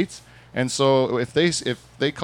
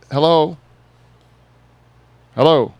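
calling hello; 3 semitones higher, 7 dB louder than usual. Real, not acted. Answering a phone call.

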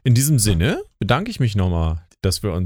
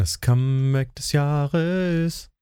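slow announcement; 5 semitones higher, much slower than usual. Pretend. Repeats title as grand announcement.

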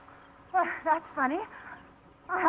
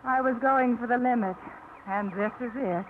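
usual speaking voice

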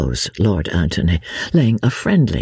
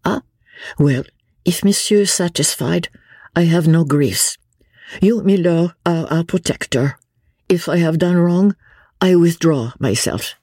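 higher-pitched, dignified French vicomte; dignified Frenchman; 8 semitones higher than usual. A narrator's reading. Young Vicomte yielding to milord, dignified. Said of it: "he said, with becoming dignity".

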